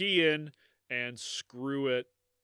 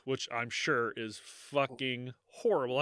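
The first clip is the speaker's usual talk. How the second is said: ordinary talk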